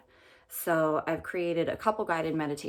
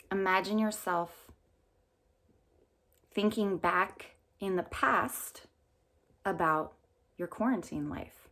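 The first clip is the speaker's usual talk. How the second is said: calm meditation guide with long pauses; unhurried phrases, leaving room to breathe between them; guided meditation. Real, not acted.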